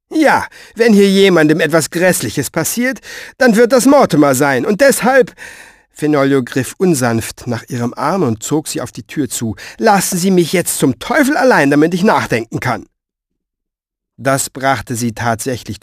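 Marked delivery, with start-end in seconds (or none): 0.1-5.3 high, forceful rebuke; heated, loud retort; irate old writer. Acted. 9.8-12.9 high, heated start easing down; fed-up outburst; exasperated dismissal. Acted. Fenoglio throws her out, exasperated.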